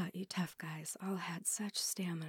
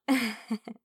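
teasing laugh; 4 semitones higher, 10 dB louder than usual. Acted. Teasing laugh in intimate scene.

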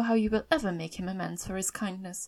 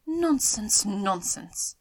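dismissive exclamation; 7 dB louder, much slower than usual. A narrator's reading. Missus Bennet dismissing her husband's idea, exasperated.

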